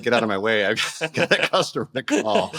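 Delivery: imitating a busy worker, breaking into laughter; playful impatient imitation, laughing; mock brush-off. Mimics busy shop staff brushing him off.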